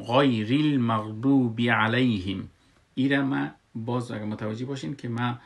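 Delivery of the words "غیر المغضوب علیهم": غیر المغضوب علیهم slow and syllable by syllable; careful tajwid reading. Shown as a demonstration. Teacher repeats the reading, غیر المغضوب علیهم slow and measured. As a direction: recite غیر المغضوب علیهم deliberately, lengthening "doo". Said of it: "غیر خواندم".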